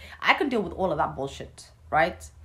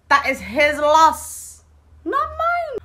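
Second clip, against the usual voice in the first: higher, louder, slow and drawn out; defiant sass; 11 semitones higher, 9 dB louder, much slower than usual. Real, not acted. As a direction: stretch it out, pitch leaping up on mine. Defiant reply about her husband.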